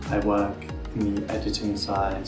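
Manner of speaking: ordinary talk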